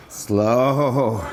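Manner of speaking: one long, held word; drawn-out slow. Shown as a demonstration. Coach pacing group back up, drawn-out slow. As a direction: let the vowel run on and sink. Coaching slowness during exercise.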